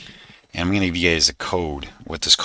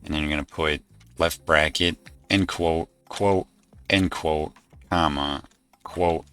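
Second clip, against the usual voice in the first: dictating. Shown as a demonstration. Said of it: "and this is the code".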